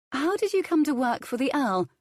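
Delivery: high, bright inquiring lady's voice; curious lady. A narrator's reading. Violet asking the cook about the Earl, high. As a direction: ask politely, eager to know.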